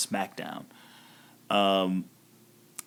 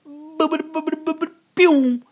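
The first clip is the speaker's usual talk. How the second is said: high sung boops then pew; high mock music noise, then a louder pew; imitated entrance music; 3 dB louder than usual. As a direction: chirp the tune high.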